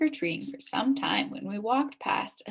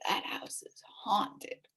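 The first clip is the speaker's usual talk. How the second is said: softer, very slow spooky whisper; ominous warning; 8 dB quieter, much slower than usual. Acted. Billy warning the kids about the house, spooky whisper. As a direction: hush it like a ghost story. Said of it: "Billy warned us".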